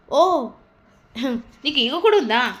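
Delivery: mocking sneer at her ego; lightly voiced sarcasm, leaning on కూడా; sarcastic. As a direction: tease her with mild scorn. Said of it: "అని వెటకారంగా".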